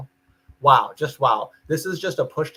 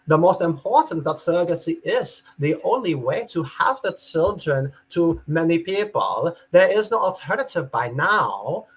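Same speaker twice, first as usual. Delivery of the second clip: put-on European accent; 4 semitones higher than usual. Reading out a foreign woman's comment in a European accent. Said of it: "How's my European accent?".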